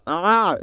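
short high, loud "ah!"; exasperated outburst cutting off the sentence; frustrated yell. Acted. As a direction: cry out in mock frustration. Acts out frustration at repeating herself.

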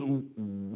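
soft, low groan of dawning trouble; dismayed low "ooh". Acted. King hearing Daniel is accused, quiet dismayed groan. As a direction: let out a small, sunken ooh. King's dismayed reaction, acted.

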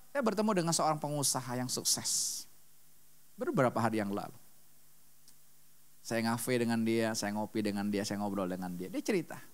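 usual speaking voice

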